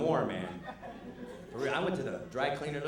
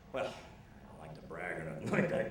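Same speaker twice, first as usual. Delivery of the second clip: much lower, quieter humble voice; mock modest dad; 9 semitones lower, 4 dB quieter than usual. The dad downplaying himself to the kids, much lower voice. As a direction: shrug it off low.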